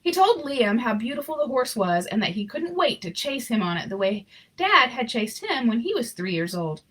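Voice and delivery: ordinary delivery, nothing marked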